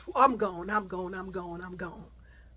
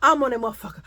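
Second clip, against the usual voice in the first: ordinary talk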